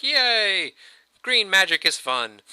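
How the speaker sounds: high, gleeful little yelp of joy; excited kid cheer. Retold or imitated. Kids cheering as sprouts grow, a high mock yay. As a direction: cheer like a kid thrilled by magic. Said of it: "they're like".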